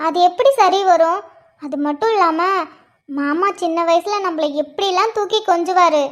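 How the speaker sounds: normal talking voice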